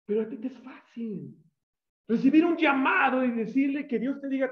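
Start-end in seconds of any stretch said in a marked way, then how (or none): none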